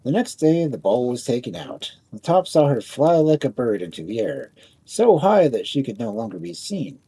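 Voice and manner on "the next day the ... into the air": ordinary delivery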